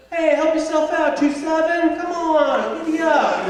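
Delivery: high, loud infield chatter; baseball chatter. Retold or imitated. Baseball players chattering on the field, high loud chirping. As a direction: chirp encouragement from the dugout, pitch up. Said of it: "You hear a little bit".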